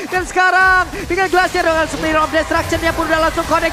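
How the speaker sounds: rising hype play-by-play call; fighting game announcer. Acted. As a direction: build it up to a big kill shout. (komentator esports yang makin heboh)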